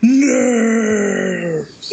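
high shouted cheer. Acted. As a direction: yell the word out. Shouting the week's topic.

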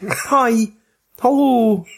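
high-pitched mock American greeting; imitating American accent. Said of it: "they sound like this".

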